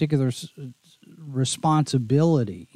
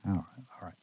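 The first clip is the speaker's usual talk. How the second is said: usual speaking voice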